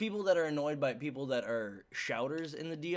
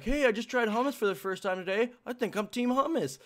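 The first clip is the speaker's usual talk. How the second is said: clueless fan voice; 9 semitones higher, 5 dB louder than usual. Retold or imitated. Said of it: "people that are like".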